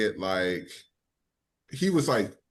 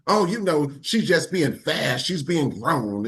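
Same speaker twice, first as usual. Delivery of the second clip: dismissive mom; 3 semitones higher than usual. Retold or imitated. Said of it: "his mom was so".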